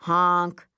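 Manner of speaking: loud, level car-horn honk; imitated car honk. Car horn breaking into rooftop talk, honking. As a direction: blare it like a horn.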